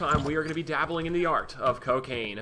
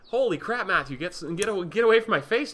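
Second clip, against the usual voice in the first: startled; 5 semitones higher, 5 dB louder than usual. Real, not acted. Startled by NPC up close.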